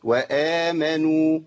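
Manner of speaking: slow chant with وآمنوا held long; clear madd. Shown as a demonstration. Teacher modelling the badal for students, وآمنوا slowly drawn out. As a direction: stretch the aa of وآمنوا evenly. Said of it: "البدل لابد من بيانه".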